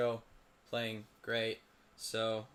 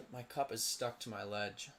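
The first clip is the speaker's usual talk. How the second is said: ordinary talk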